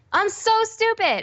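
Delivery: mock whine at herself; whiny self-scolding. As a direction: wail it like a sulky child. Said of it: "And I'm like".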